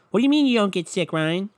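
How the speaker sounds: a high, whiny scoffing voice that needles Ryan; mocking doubter voice. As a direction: play the scoffing friend who doubts him.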